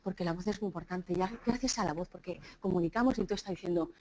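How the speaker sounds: low, soft monotone drone; dull monotone speech. Shown as a demonstration. Speaker showing listeners how a dull talk loses them, monotone. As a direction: drone it quietly on one low note.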